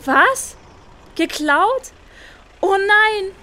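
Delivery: put-on girl discovers her phone was stolen, alarmed; shocked discovery. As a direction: gasp it out as you notice it's gone. Susi finds her phone stolen.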